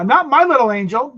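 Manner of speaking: mock outraged parent, high and loud; hammed-up offended mom; indignant mother. Mother defending son, mocked.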